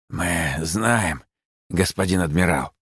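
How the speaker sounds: softly hissed creature voice; quiet hiss. A narrator's reading. Bat spy answers the admiral, quiet hiss. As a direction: hiss it submissively, a little subdued. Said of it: "тихо прошипела крыса".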